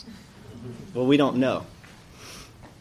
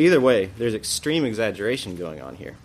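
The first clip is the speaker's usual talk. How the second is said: ordinary talk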